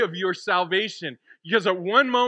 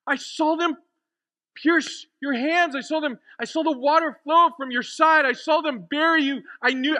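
high, halting, stumbling insistence; overwhelmed disbelief; 7 semitones higher, 3 dB louder than usual. Acted. Mary telling Jesus she saw him die, overwhelmed. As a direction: pour it out in stunned, emotional bursts.

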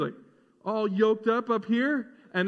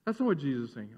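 usual speaking voice

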